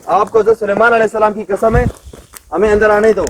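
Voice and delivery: loud, high commanding call at the unseen jinn. Real, not acted. Exorcist to the jinn, a loud forceful command. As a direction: order it to let you in, forcefully.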